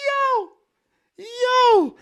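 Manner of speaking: very high squeaked protest; exasperated anger. Retold or imitated. DJ told he could have moved it, high exasperated yelp. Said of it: "getting even more mad".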